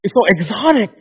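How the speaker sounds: high, loud mock gushing; gushing admiration. Admirers gushing over an immigrant's accent, mock gushing. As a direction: imitate someone cooing over an accent. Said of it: "they're like".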